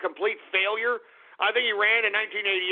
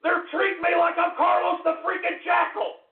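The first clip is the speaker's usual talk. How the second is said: angry shout; 9 semitones higher than usual. Acted. Host yells to listeners he's treated like a terrorist, angry shout. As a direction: bellow the grievance at the audience. Rant about government persecution.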